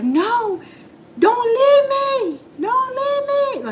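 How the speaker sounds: very high, slow, drawn-out wailing plea; retold desperate plea. Neighbor chasing husband's truck, imitated high slow wail. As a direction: stretch it out high like a begging woman. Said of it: "And she's like".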